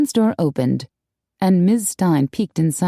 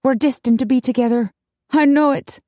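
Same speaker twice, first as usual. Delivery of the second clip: higher-pitched, smitten certainty; dreamy; 6 semitones higher than usual. A narrator's reading. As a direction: gush it like a starry-eyed romantic. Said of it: "with a dreamy look".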